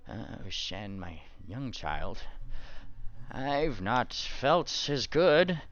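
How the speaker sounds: slow, drawn-out voice of a recovering cleric; weary old cleric. Acted. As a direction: address a young follower slowly, coming round.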